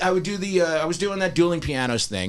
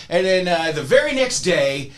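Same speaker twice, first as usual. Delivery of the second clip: ordinary talk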